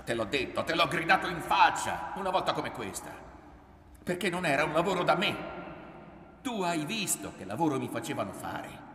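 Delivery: loud, high, hurried protest; angry self-defence. A narrator's reading. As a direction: throw it in her face. Son argues back, recalling shouting.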